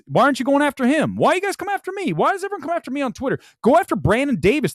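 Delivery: high, fast exasperated complaint; high-pitched aggrieved rant; indignant outburst. Real, not acted. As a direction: protest shrilly at the audience.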